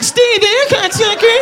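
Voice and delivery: squeaky tiny dough man; high Doughboy voice. Acted. Comic voicing the Doughboy greeting his friend. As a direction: voice pushed up high and small. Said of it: "The Pillsbury Doughboy's like".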